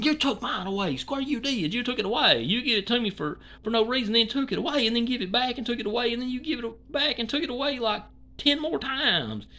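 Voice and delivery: high whining impression of a YouTuber; mock whiny Square. Mocking Square's whining at the wrench Nazi, high whine. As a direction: whine it up high like a spoiled complainer.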